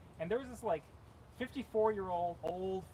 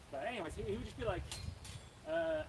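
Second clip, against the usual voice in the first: ordinary delivery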